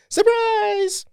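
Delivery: high, bright party yell of surprise; excited surprise shout. Retold or imitated. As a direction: leap out and yell it. Retelling the surprise party moment.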